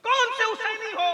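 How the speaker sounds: high-pitched rebuking question; indignant challenge. Real, not acted. Preacher rebuking false followers of Hussain, high indignant challenge. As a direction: throw the question at them.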